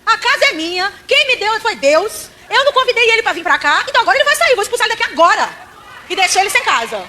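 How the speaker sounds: high, loud commanding proclamation; retold bold declaration. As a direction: shout it out, claiming your house back. (proclamação alta e autoritária)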